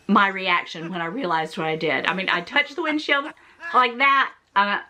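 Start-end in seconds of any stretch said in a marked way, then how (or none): none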